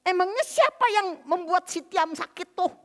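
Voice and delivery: high, loud re-enacted tirade at a father-in-law; angry accusing outburst; furious confrontation. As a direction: blast the in-laws with blame, hands on hips. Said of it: "saya udah marah luar biasa".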